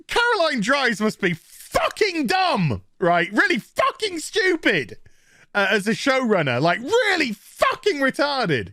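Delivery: high incredulous rant; exasperated rant. Real, not acted. Reviewer ranting about the showrunner, high exasperated. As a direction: vent at the showrunner.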